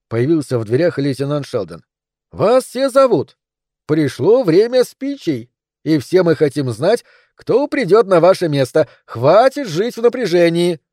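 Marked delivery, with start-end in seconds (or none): 2.3-10.8 higher, animated, insistent call; rally him along with a lifted, lively voice; eager summons. A narrator's reading. Lieutenant calls the captain to the speeches, eager.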